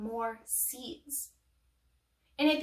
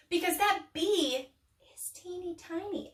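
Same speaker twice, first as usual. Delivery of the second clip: normal talking voice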